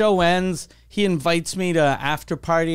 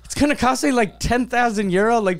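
higher-pitched mock alarm at the bill; retold shock; 5 semitones higher than usual. Drunk customer learns the round's price, retold shock.

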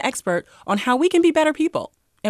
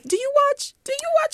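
high, sweet, cautious asking; high polite asking voice. Acted. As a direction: ask it gently, way up high. Acting out a polite lead-in.

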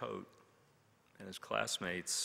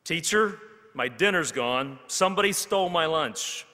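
higher, much louder angry outcry; Big Tom complains to the teacher about his stolen lunch; angry complaint; 6 semitones higher, 12 dB louder than usual. A narrator's reading. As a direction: shout the grievance at teacher.

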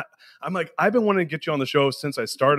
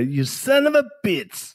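put-on slow, higher Persian-accented curse; father's Persian accent; 9 semitones higher, slower than usual. Son quoting his father's cursing, Persian accent. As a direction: mimic dad swearing in his accent. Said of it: "As my father would say".

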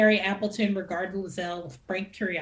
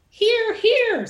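loud, high Hear, hear; surprisingly loud; 14 semitones higher, 8 dB louder than usual. A narrator's reading. Gladys Banfield cutting off the toast, surprisingly loud and high. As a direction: cut him off with a loud cheer. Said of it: "surprisingly loud".

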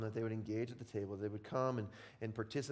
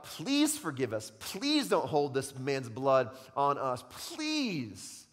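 pleading sailors; 3 semitones higher, 7 dB louder, much slower than usual. Acted. Sailors begging God for mercy.

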